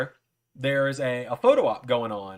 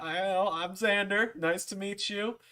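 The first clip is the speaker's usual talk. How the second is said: high, slowed, awed introduction; starstruck; 8 semitones higher, slower than usual. Retold or imitated. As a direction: introduce yourself timidly to your hero. Fan meets his idol, retold.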